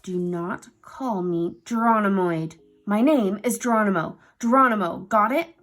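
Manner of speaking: loud insistent correction; angry. A narrator's reading. Uncle furious at his nickname, loud correction. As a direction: hammer home the name.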